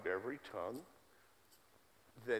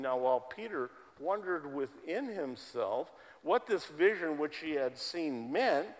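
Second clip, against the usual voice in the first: louder, declaiming Bible reading; scripture reading; 4 semitones higher, 5 dB louder than usual. Real, not acted. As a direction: proclaim the verse to the congregation. Reads scripture passage aloud.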